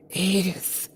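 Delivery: weak groaning call; weak tired groan. A narrator's reading. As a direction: groan the name out, barely voiced. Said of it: "I groaned".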